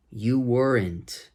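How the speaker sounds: You weren't, slowed right down, each word separate; slow careful demo. Shown as a demonstration. Teacher showing students the break between words, slow and deliberate. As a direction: model "You weren't" slowly without linking the words. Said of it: "Por que eu tô colocando esse tracinho aqui".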